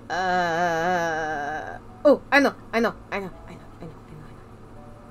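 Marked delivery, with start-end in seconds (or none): 2.1-5.1 excited muttering. Real, not acted. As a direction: repeat it rapidly to yourself, dwindling into a whisper. Idea dawns, chant fades away.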